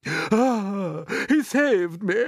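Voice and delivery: high, loud panting relief; breathless, overcome centipede; gasping. A narrator's reading. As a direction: gasp it out after nearly drowning. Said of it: "gasped the centipede".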